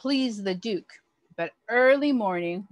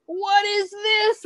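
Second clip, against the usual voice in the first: much higher, louder, slow angry roar; 12 semitones higher, 4 dB louder, slower than usual. A narrator's reading. Duke roars at the baker's roll. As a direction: bellow at the bad roll. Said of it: "roared the Duke".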